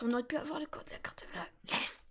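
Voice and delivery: quick conspiratorial whisper. Acted. Streamer joking to chat about the bank card, whispered fast. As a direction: hush it fast like a secret.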